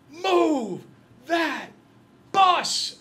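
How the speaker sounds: very slow, high, loud yell; catchphrase shout. Retold or imitated. TV host roaring at the bus driver, slow loud shout. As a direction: drag out every word at full shout. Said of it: "and goes".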